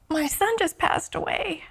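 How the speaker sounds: high, very soft, on the edge of tears; tearful. Retold or imitated. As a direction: retell it through rising tears. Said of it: "I just started to cry".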